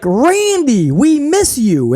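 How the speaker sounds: high, slow pleading impression; mock needy Riddle. Imitating Riddle begging Randy, mock needy. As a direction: plead like a lost stepchild. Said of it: "He's like".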